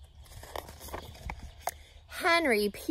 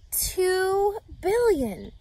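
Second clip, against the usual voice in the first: drawn out, high and amazed; slow emphatic awe; 8 semitones higher, much slower than usual. A narrator's reading. Stressing a huge number to children, slow awe. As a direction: stretch it out with wonder. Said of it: "emphatic repeat for children".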